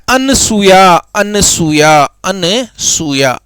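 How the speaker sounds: slow demonstration. Shown as a demonstration. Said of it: "Anasuya शब्द क्या होता है".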